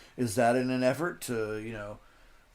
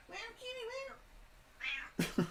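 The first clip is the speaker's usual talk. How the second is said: very high, soft, drawn-out meow; cat meow; 16 dB quieter, much slower than usual. Real, not acted. Streamer meowing back at his old cat, soft and high.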